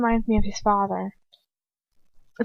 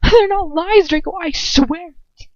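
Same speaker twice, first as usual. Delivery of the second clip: high, loud plea that she's telling the truth; desperate pleading; 7 semitones higher, 7 dB louder than usual. Acted. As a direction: beg him to believe you. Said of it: "I say desperately".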